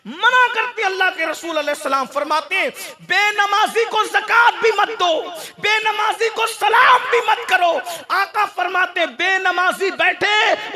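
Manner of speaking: high, forceful shouted warnings; impassioned preaching. Real, not acted. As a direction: hammer each prohibition into the crowd.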